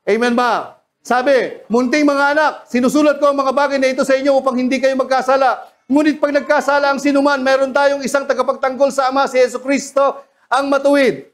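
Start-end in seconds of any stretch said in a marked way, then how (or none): 1.7-11.2 brisk, raised reading of the verse; scripture reading. Acted. Preacher reading scripture to the congregation, quick and raised. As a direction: read the verse out to the church, quick and lifted.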